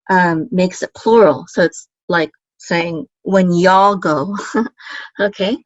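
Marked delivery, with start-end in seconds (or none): 4.4-5.2 brief, soft breathy chuckle; small laugh. Real, not acted. Teacher amused by "y'all" translation, small soft laugh. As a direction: chuckle lightly at your own wording.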